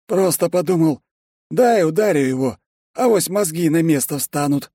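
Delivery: high-pitched, breezy explanation; unrepentant secretary. A narrator's reading. Secretary explaining why he hit the boss, high and unrepentant. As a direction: reason it out high and innocently. Ben's character voice explains blow.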